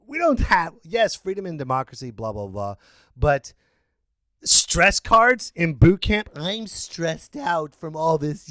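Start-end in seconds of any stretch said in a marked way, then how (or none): none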